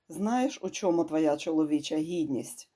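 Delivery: ordinary delivery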